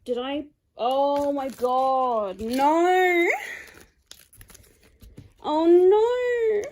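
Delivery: panicked, building into a loud high cry; real dismayed cry. Real, not acted. Cuts the package by accident.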